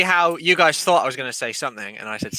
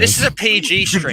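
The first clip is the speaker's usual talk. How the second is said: prissy scold, higher; 5 semitones higher, 5 dB louder than usual. Pretend. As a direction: tut at them primly. Mock prim scolding.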